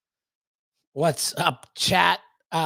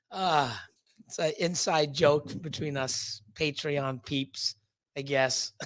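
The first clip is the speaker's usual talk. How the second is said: normal talking voice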